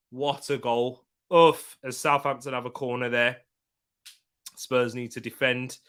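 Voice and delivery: usual speaking voice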